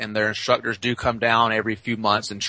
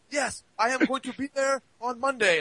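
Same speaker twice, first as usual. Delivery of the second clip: mock formal reply; 12 semitones higher, 6 dB quieter than usual. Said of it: "he's like".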